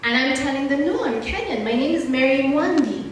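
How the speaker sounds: ordinary talk, nothing marked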